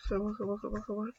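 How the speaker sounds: very soft rapid muttering; hushed urging. Real, not acted. Gamer urging himself on mid-match, very soft mutter. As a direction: mutter it urgently under your breath. Urging self while playing.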